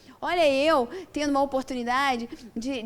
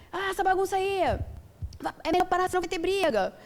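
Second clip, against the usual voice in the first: higher, calling from inside the house; father calling out; 6 semitones higher than usual. Retold or imitated. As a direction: warn the noisy kids from another room. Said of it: "meu pai já mandava lá de dentro". (o pai gritando lá de dentro, leve)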